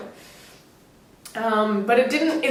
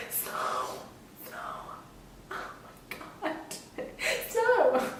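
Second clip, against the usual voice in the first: awed whisper to squeal; 7 semitones higher, 10 dB quieter, slower than usual. Retold or imitated. Taking photos in the snow.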